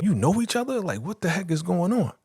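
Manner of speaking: high, incredulous retold questioning; imitating someone thrown by a surprise; baffled. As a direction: act out disbelief in a high voice. Retold shock at their acquaintance.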